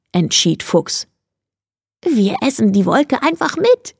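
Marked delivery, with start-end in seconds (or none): 2.0-4.0 higher, gleeful lilt; a touch of giggly glee, pitched up; giggling. A narrator's reading. Hare has an idea for Fox, mildly giggly. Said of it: "kicherte Hase".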